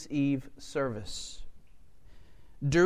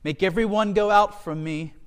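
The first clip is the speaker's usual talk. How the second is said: raised, louder cry from Joseph; 4 semitones higher, 7 dB louder than usual. A narrator's reading. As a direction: read it as an urgent command called out. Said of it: "He cried".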